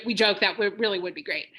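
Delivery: ordinary talk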